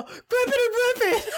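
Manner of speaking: laughing catchphrase yell; catchphrase cry. Acted. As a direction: blurt it out through laughter. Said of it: "the catchphrase that I've been, um, workshopping".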